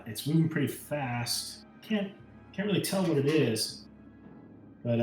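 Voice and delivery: normal talking voice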